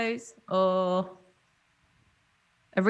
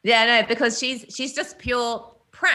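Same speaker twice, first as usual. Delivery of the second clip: ordinary talk, nothing marked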